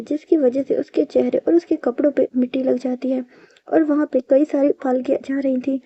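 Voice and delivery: usual speaking voice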